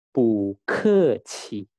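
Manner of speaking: "bu ke qi" very slow, syllable by syllable; Mandarin model. Shown as a demonstration. Teacher shows how to read it, slow careful Mandarin. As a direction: draw out "bu ke qi" with puffed k and q. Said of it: "ini cara membacanya".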